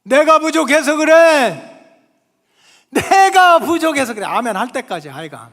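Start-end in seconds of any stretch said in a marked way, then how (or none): 0.0-4.2 higher, louder, slow, 내가 hammered; fervent shout. Real, not acted. Preacher leading congregation, shouting. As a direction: cry out 내가 부족해서 그래 slowly. Repeated phrase, shouted take.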